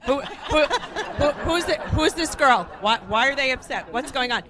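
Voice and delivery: the wife at the table demanding answers, playing a flustered mock panic. Lightly voiced flustered wife. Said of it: "And the wife's like".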